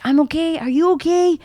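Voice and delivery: retold high-pitched check-in; high reassurance. Crash victim reassuring the bawling driver, high-pitched reassurance.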